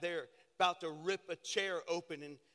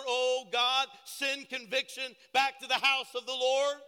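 loud preaching cry to God; fervent cry; 5 semitones higher, 8 dB louder than usual. Real, not acted. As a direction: call out to God with full force. Preacher cries out a prayer.

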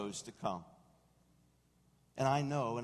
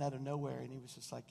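ordinary talk

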